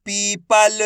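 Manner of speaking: पीपल sounded out in long syllables; drawn-out long ई. Shown as a demonstration. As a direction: stretch पी in पीपल. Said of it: "खींच के पढ़ा जाता है".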